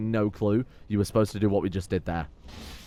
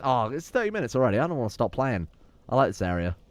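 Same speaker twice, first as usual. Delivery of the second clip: ordinary delivery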